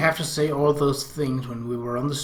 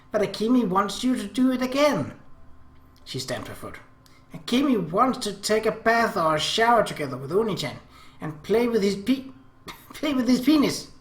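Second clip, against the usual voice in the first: put-on higher girlish pleading with a giggle; coy little sister; 7 semitones higher than usual. Little sister pleading with Oni-chan, higher girlish giggly voice. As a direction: wheedle in a sweet high voice, breaking into a laugh.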